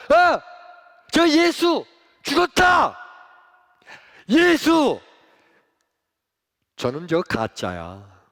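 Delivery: loud, high jeering yell at the cross; mocking shout. Crowd jeering at Jesus on the cross, loud mocking shout. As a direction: jeer him as a fake, full voice.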